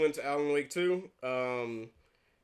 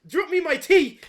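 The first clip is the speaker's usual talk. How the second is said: mock British; 14 semitones higher, 9 dB louder than usual. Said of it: "too much British".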